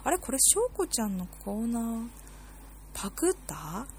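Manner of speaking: 少し高めに、とぼけて疑う; 思わず浮かんだ疑惑を冗談めかして口に出す; 疑いのツッコミ. Acted. 疑う心の声を演じる. (わざとらしく疑う声)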